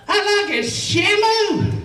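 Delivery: high, loud mock shout; shouted heckle. Someone on shore yells at the big man wading in, shouted. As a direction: holler it high across the beach.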